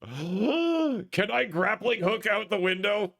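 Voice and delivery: gasp, then high and slow; excited gasp. Real, not acted. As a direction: struck by a bold idea. Sudden idea, gasps.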